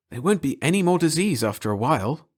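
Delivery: plain reading voice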